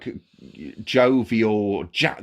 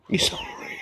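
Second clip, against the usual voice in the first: whispered echo; whispering; 5 dB quieter, faster than usual. Real, not acted. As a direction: breathe it out, voiceless. Echoing partner, whispered.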